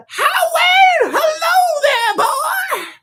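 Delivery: high, loud shrieking imitation of Jana; mock Jana impression. Streamer reading chat, shrieking a bad imitation of Jana. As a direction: screech it out like a bad copy of her. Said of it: "That's not how she sounds".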